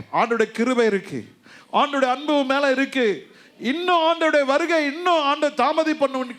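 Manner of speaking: high, loud fervent sermon; full-force preaching. Acted.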